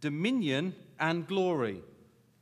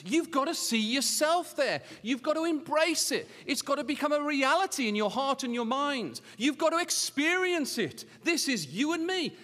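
high, insistent exhortation; ringing sermon appeal, each phrase pressed home; impassioned preaching; 9 semitones higher, 6 dB louder than usual. Real, not acted. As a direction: urge the congregation with raised pitch. Preaches at full force.

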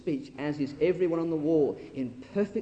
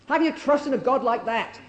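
venomous scorn; 8 semitones higher, 6 dB louder than usual. Acted. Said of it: "See the venom behind that?".

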